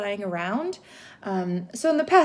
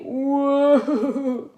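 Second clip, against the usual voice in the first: laughing whoa; 2 semitones higher, 3 dB louder than usual. Real, not acted. Octopus drawing looks odd.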